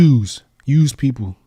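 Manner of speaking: loud, drawn-out New York "youse"; imitated New York dialect, loud and slow. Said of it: "She says, "Use, use people".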